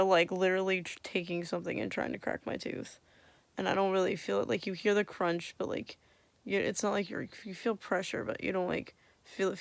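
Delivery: ordinary talk, nothing marked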